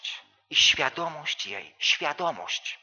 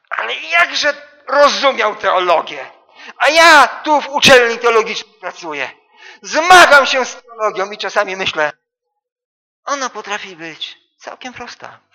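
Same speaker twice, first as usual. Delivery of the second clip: loud, high, fervent preaching; preaching at full force; 9 semitones higher, 15 dB louder than usual. Acted. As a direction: proclaim it to the whole church. Preaching climax about theology.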